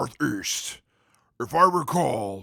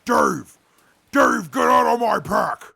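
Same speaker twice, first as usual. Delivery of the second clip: a loud, annoyed bark at the intruder; shouting at Dave to leave the pack alone; angry shout; 9 semitones higher, 8 dB louder than usual. Acted. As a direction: yell sharply at Dave to get out. Scolding Dave rummaging his pack.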